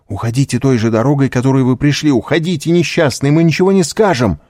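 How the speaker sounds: высокий голос, нарастающий к концу; торопи беглеца уйти, всё настойчивее; взволнованно торопит. A narrator's reading. (взволнованно и настойчиво)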